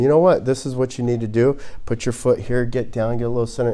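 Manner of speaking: mild-mannered coach teaching quietly; nicely and gently. Retold or imitated. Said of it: "very nicely and gently".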